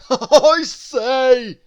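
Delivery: a jolly plump man amused; Mister Greedy. A narrator's reading. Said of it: "chuckled Mister Greedy".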